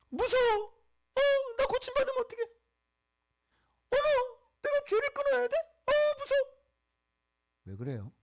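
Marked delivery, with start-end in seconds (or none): 0.1-6.6 very high, squealing mock panic; hammed-up frightened little voice; mock fear. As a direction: play a scared whiner in falsetto. Acting people afraid of being healed.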